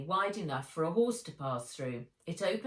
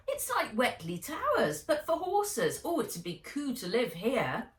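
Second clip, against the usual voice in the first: put-on high, excited young girl's voice; bubbling over with excitement at the place; awed girl; 7 semitones higher, 3 dB louder than usual. Nat tells Henry about the grand new stables, high and thrilled.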